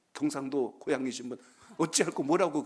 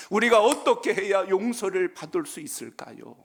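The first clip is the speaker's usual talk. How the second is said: 부르짖음; 6 semitones higher, 6 dB louder than usual. Acted. 간절한 부르짖음을 연기.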